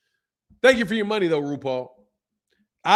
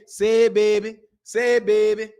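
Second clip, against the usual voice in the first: higher, louder New Orleans imitation; New Orleans accent; 4 semitones higher, 4 dB louder than usual. Streamer fondly mimics a city he lived in, New Orleans accent. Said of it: "I love the accent".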